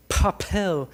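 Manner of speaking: "papel" with a puff of air on the p's; paper test word. Shown as a demonstration. Teacher shows the paper test for the puff on "papel". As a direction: say "papel" at a paper and make it move. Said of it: "les hago sacar un papelito así".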